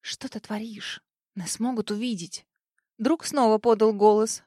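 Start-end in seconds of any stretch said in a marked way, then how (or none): 0.0-1.0 hushed whisper on творишь. Acted. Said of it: "понизила голос до шёпота". 1.4-2.5 hushed whisper, breathy. Acted. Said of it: "понизила голос до шёпота".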